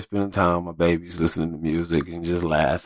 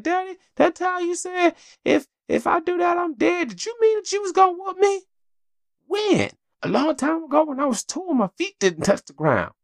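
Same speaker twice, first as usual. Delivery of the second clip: put-on much higher, chirpy little-girl voice; imitating little daughter. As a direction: play a four-year-old rattling off a memory. Voicing daughter asking about whooping.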